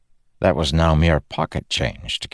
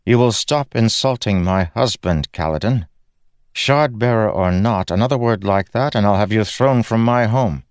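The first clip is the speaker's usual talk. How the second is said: higher, icy lady of the house warning; cold threat from Laurel; 5 semitones higher than usual. Acted. Laurel warns Kaladin off her husband, icy and firm. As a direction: draw the line, each clause firmer.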